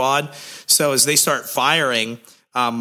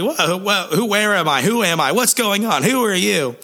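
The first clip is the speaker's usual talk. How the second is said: mock confused waking; 8 semitones higher than usual.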